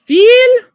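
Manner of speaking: "Fille" called out high and loud as "feey"; French demo. Shown as a demonstration. Teacher stressing the French word, high and loud. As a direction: belt out "Fille" like calling the class. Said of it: "the S is silent".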